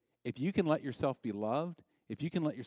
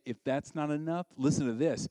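ordinary delivery

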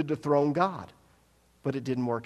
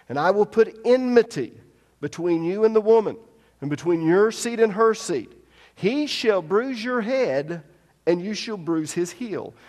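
loud, raised reading of the verse; scripture reading; 6 semitones higher, 7 dB louder than usual. Acted. Preacher reading God's words to the serpent, loud proclaiming. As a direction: proclaim the verse like a sermon text.

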